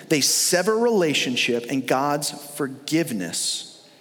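ordinary talk, nothing marked

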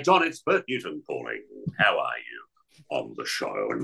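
a hint of a low, deep Bert Newton; lightly voiced TV host greeting; Bert Newton impression. Calling John Deeks as Bert Newton, low host voice. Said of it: "I've done a Bert Newton".

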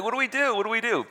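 high, helpless mock panic; comic panic. Both men panicking.

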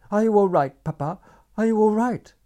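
high, loud, anxious cry through the door; frightened calling. A narrator's reading. Daughter calling through a locked door, high and frantic. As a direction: call out in fear to your father.

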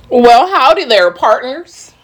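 slow, high hammed-up cowboy greeting; mock Southern howdy. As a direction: play a joking Texan drawl. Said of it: "Let me stop playing".

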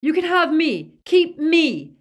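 loud, high, desperate plea; shouting. A narrator's reading. Boy offers himself in his friend's place, desperate shout. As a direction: yell it as an urgent offer of yourself. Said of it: "shouted Ron".

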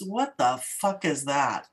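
slow, low gruff disbelief; imitated dad's outburst. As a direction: mimic the father's baffled curse. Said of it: "just the way he says it".

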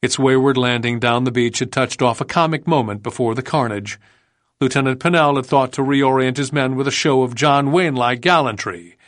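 ordinary delivery, nothing marked

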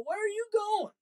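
high call down the aisle; calling after her. Retold or imitated. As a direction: shout after someone leaving. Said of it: "And I go".

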